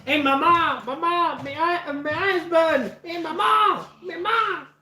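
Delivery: put-on high wailing Mexican accent, calling for mama; high, hammed-up call to mama with burning eyes. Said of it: "you sound like a Mexican dude".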